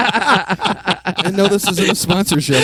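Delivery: laughing through the words; giggling while protesting. Real, not acted. Laughing denial.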